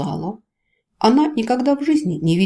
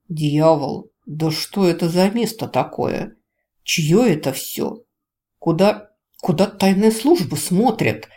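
ordinary delivery

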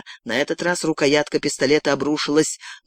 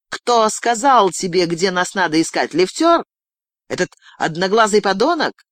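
high, insistent interrogation; demanding; 5 semitones higher, 3 dB louder than usual. Acted. Girl grilling captive about who betrayed them, demanding. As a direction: press for the name, voice climbing on the guesses.

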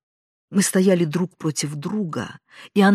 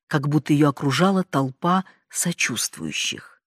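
usual speaking voice

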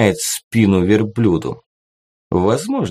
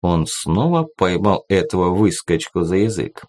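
ordinary delivery, nothing marked

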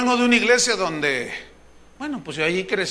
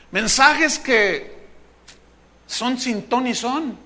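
slow emphasis; 3 semitones higher, slower than usual. Real, not acted. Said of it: "slow emphatic delivery".

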